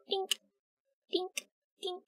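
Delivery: tiny, high, soft "dink"; cute wink sound. Pretend. Streamer asked for winks, cute soft sound effect. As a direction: make a little soft blip for each wink.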